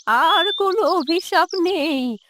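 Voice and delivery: tearful, drawn-out plea to God; grieving old woman praying; forgiving old mother. Acted. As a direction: plead for your son in a quavering old voice.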